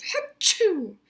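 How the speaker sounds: high, acted "hachoo" sneeze; mock sneeze. A narrator's reading. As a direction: fake a big storybook sneeze. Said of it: "he sneezes".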